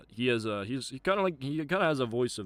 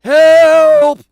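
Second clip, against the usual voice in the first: much higher, much louder panicked cry; a hammed-up shriek for help; screaming for help; 27 dB louder than usual. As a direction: scream for rescue in mock terror.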